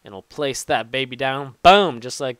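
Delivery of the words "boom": loud punchy exclamation; triumphant boom. Real, not acted. Placing the crafting table, loud triumphant shout. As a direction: slam it down with a shout.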